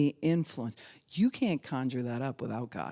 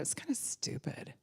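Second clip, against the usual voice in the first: snide whisper; 7 semitones higher, 10 dB quieter than usual. Acted. Mocking critical churchgoer.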